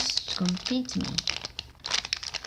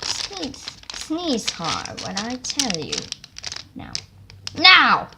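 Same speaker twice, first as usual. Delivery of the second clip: shouting; 4 semitones higher, 6 dB louder than usual. Acted. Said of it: "they shouted".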